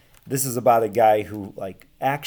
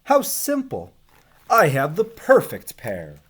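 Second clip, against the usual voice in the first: much higher, bright and eager, sure of his craft; eager shoemaker; 4 semitones higher, 6 dB louder than usual. Acted. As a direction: pop the first words up high with glee. Hans offers shoes confidently.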